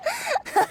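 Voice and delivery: short high chuckle at being caught; amused laugh. Acted. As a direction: laugh off the scolding. Caught joking about liquor.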